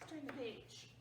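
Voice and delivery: usual speaking voice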